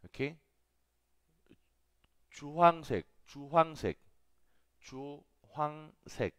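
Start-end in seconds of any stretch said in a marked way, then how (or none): 2.3-6.3 Chuhangsek with a ch, last take stretched slowly; teacher models Chuhangsek, slowing at the end; model pronunciation. Shown as a demonstration. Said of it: "Así más o menos esa es la pronunciación".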